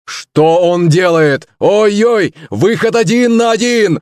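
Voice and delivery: high commentator shout, long held; football commentator. Acted. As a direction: scream the goal call. Commentator voice again.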